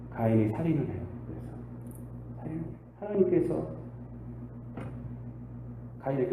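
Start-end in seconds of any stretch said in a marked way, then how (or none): none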